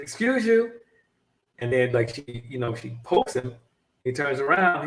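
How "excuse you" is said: indignant woman. Retold or imitated. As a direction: repeat it like she's fed up. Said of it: "He said".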